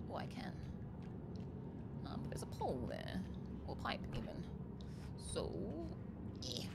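hushed sneaking whisper. Real, not acted.

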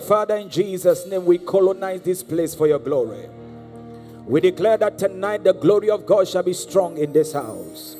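normal talking voice

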